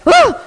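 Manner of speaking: high, loud startled scream; imitated scream. Woman in the tube sees the X, sudden high scream. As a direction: shriek as the shock hits.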